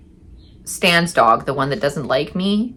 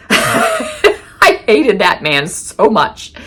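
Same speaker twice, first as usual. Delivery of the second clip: slow, high words through laughter; laughing vehemence; 6 semitones higher, 4 dB louder, much slower than usual. Real, not acted. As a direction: laugh out the confession, drawn out.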